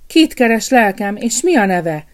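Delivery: cook's cheerful greeting; kindly cook. A narrator's reading. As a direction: welcome him into her kitchen. Cook greets stranger in kitchen.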